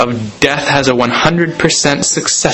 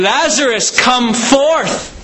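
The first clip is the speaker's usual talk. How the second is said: a higher, drawn-out preacher's call, not truly shouted; mock loud call; 7 semitones higher, slower than usual. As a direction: call Lazarus out of the tomb, pitched up. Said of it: "He cried out in a megaphone".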